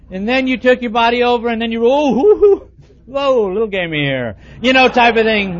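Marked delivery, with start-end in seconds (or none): 1.8-4.4 loud, high hammed-up recoil from a smell; comic startle at a bad smell; mock shock at own smell. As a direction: recoil in mock alarm at your own odor. Smelling own body odor.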